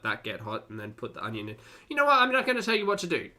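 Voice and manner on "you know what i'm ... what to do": high, loud and fast, throwing up your hands; loud fed-up exasperation; exasperated outburst. Real, not acted. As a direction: burst out in mock surrender, rushing. Exasperated at cook's technique.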